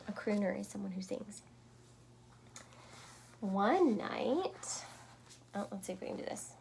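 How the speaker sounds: usual speaking voice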